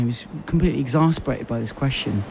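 ordinary talk, nothing marked

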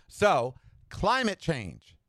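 "climate change" said very slowly, each word weighed; slow emphatic announcement. Acted. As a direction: announce the topic gravely and slowly. Slow emphatic topic intro.

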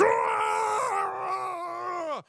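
long, high, loud roar; shrieking growl held long; demon roar. Retold or imitated. Preacher imitating the demon's reaction, long loud roar. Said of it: "the demon was just kinda".

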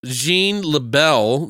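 normal talking voice

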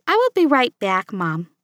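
usual speaking voice

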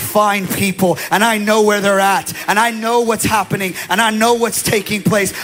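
loud, driving declaration in God's voice; preaching as God's voice. Acted. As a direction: proclaim God's promise with full force. Pastor voices God's message.

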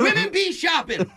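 hammed-up comic delivery; comedian impression. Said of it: "Can I do one?".

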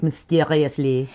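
slow, low French-accented "mysteriously". Shown as a demonstration. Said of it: "I'm gonna French accent this so hard".

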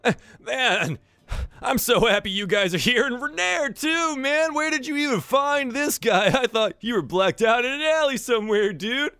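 high, loose dude voice, arms wide; surfer dude hug. Acted. Floon calling the pretty lady in for a hug, high dude voice. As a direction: pull her into a goofy grateful hug.

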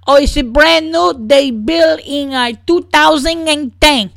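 put-on high slow Spanish-accented bellhop. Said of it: "he's a Spanish dude".

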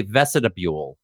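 "vestibule" said as ves-ti-da-bule; extra syllable. Shown as a demonstration. Correcting how he said it, "vestibule" with extra syllable. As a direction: slip an added syllable into "vestibule". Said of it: "Like an extra syllable".

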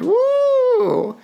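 very high, sustained woo; high "woo" cheer. Real, not acted. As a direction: let out a long high-pitched whoop. Cheer for Pride.

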